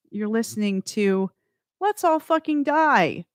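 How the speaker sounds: usual speaking voice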